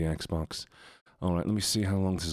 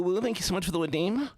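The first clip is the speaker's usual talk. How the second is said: squeaky voice; 11 semitones higher, faster than usual. Pretend. Squeaky thank-you for redeem.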